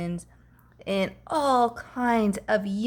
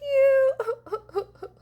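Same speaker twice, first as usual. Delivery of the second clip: high rising sob-laugh; a comic monster boo-hoo; wailing cry-laugh. Acted. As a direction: let it break into a blubbery wail. Monster's wail.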